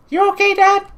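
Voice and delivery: put-on squeaky kid answering his dad. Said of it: "I tried a kid voice".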